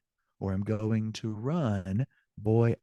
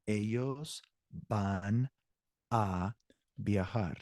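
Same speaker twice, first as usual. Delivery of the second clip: one Spanish word at a time; 4 dB quieter, slower than usual. Shown as a demonstration. Teacher translating for Spanish students, word-by-word with pauses. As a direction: pause after each word so learners can follow.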